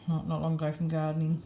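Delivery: normal talking voice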